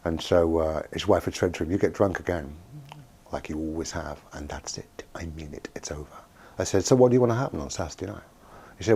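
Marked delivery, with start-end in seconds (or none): 1.7-6.2 quiet ultimatum. Retold or imitated. Wife warns husband about his drinking, quiet ultimatum. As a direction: lay it down softly, with finality. Wife's ultimatum in retold quote.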